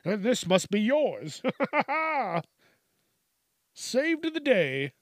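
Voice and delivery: high, hammed-up hero voice; high-pitched mock-heroic flourish. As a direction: play a hammy do-gooder with a big laugh.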